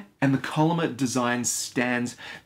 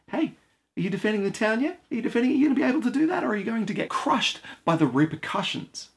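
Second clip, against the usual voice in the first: higher, quick-rising little voice; nagging inner voice; 7 semitones higher, faster than usual. Acted. Little voice in the player's head checking on town defense, nagging. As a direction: pester like a worry in the head. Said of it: "a little voice in the back of your head saying".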